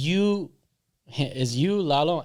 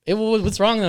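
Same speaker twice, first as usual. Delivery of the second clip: higher-pitched, stumbling question; probing concern; 8 semitones higher, 3 dB louder than usual. Retold or imitated. Friend pressing upset Johnny, high probing question. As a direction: ask again with a lifted, coaxing voice.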